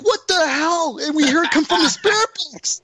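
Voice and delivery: high-pitched imitation of startled crew; mock astonishment. Crew reacting to a spirit box voice, high mock astonishment. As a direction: retell their shock, pitch jumping way up. Retelling crew's shock at voice.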